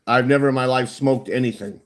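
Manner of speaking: normal talking voice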